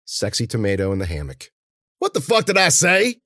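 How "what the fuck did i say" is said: high, loud, irate challenge; angry snarl. A narrator's reading. Warner scolding Mueller for the late throw, snarling. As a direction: snarl it at a bungling partner. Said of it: "Warner snarled".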